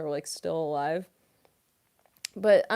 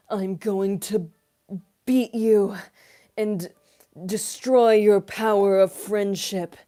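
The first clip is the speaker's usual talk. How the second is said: loud, slow villain threat; menacing villain; 11 dB louder, slower than usual. Shown as a demonstration. Villain threatening the heroes, loud and slow. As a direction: boom it out like an anime villain. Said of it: "antagonist voices are my favorite".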